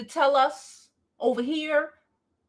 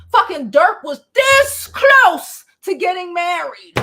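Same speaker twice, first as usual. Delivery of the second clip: voice cracking; 8 semitones higher, 9 dB louder, slower than usual. Real, not acted. Ranting, voice cracks over [name].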